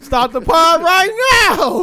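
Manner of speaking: high, drawn-out demand; mock outraged order. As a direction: escalate into a hammed-up, high-pitched halt. Repeats the halt, escalating.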